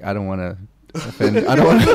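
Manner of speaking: imitating someone fighting a laugh; holding back laughter. Retelling a rapper's reaction, stifled laughter. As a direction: try not to laugh, then start cracking up. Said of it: "you could tell he wanted to laugh".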